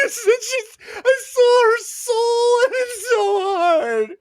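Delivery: high, slow, wailing sobs; hammed-up crying through the words; blubbering weepy mess. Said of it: "a blubbering, weepy mess".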